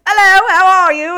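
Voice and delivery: high, loud, over-the-top hello; hearty greeting shout. Retold or imitated. Expat bar staff greeting newcomers, hearty mock shout. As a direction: boom out a big high-pitched welcome.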